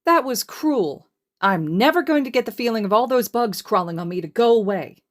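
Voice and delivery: high, loud, hammed-up whining; loud dramatic complaint. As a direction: play up the horror of the bugs. Hizashi complains about bugs.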